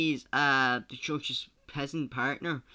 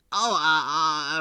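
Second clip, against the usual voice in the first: flustered; 3 semitones higher, 10 dB louder, slower than usual. Acted. Caught out, stammering.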